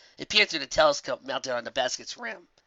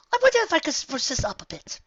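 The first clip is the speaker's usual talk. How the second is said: high, refined musing; musing Rarity. Acted. Rarity muses about sprucing up the headset, high and refined. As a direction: muse it to yourself. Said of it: "she thought out loud".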